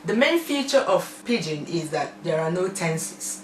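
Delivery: usual speaking voice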